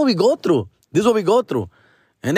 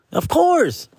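squeaky of course; 12 semitones higher than usual. Real, not acted. Mock emphatic agreement.

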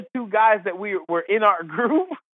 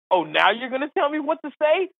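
higher-pitched, rising disbelief, retold; mock indignant retort; 7 semitones higher than usual. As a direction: throw it back as an incredulous comeback.

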